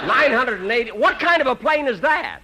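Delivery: high, loud disbelief at the answer; can't believe the number he just heard; incredulous. Acted. Incredulous at 982 stops.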